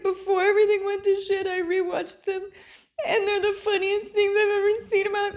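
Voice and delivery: high, fast, giggling sob; laughing through tears. Retold or imitated. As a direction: blurt it out crying with laughter. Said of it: "because I was in tears".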